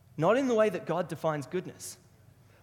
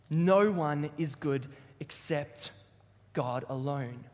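slow quote with spaced phrases, falling low; deliberate, weighty reply; 3 semitones lower, slower than usual. Acted. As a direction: let each phrase land slowly. Jesus's reply repeated for weight.

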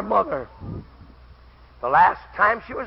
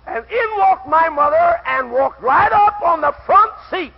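high, loud, slow proclamation; full-force preaching; 8 semitones higher, 8 dB louder, slower than usual. Real, not acted. Preacher telling of mother walking in, full-force preaching. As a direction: declare it triumphantly to the whole church.

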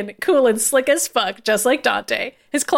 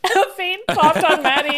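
ordinary delivery

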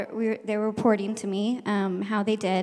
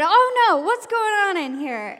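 alarmed teacher; 9 semitones higher, 6 dB louder than usual. Retold or imitated. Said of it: "he said".

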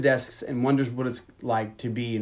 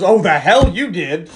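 slow, louder scoff in a put-on voice; scoffing dismissal; 5 semitones higher, 9 dB louder, slower than usual. Vera brushing off Brantley's disgrace, slow loud scoff. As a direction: wave off his shame with a slow scoff.

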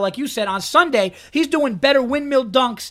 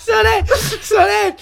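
high, slow, laughing through tears; tears of laughter while telling; crying with laughter; 9 semitones higher, 4 dB louder, slower than usual. Real, not acted. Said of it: "I am crying".

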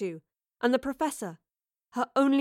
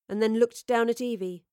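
ordinary delivery

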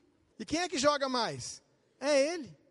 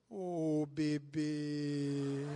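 low, soft cooing "bebê"; sweet wife voice; 11 semitones lower, 5 dB quieter, much slower than usual. Retold or imitated. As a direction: coo it like a wife calling her husband. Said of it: "ela falava assim pra mim". (voz de esposa carinhosa, baixa e suave)

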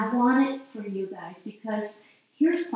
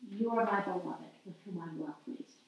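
hushed, lower, falling away softly; voice of God; 4 semitones lower, 12 dB quieter than usual. Acted. God reassuring a beloved child, hushed and low. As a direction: let it sink to a very soft, low close. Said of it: "the voice of God, I think, says".

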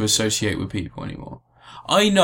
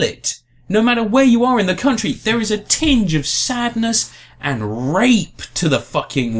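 angry rant; 8 semitones higher than usual. Real, not acted. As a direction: let the anger build higher. Ranting about the referendum.

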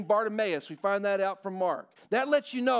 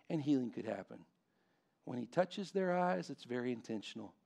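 soft, lower, reverent preaching; hushed preaching; 7 semitones lower, 8 dB quieter than usual. Acted. Pastor to congregation on Bartimaeus, hushed and reverent. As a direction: draw them in with a near hush.

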